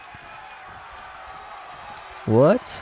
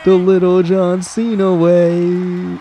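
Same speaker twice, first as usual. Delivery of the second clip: hype announcer; 6 semitones higher, 5 dB louder, much slower than usual. Real, not acted. Hype tagline repeated.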